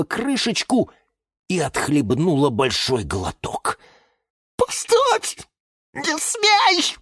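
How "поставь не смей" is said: very high, gasped commands; panicked squeal to stop her; choking alarm. Acted. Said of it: "Чуть было не задохнулся Лимон Филимон".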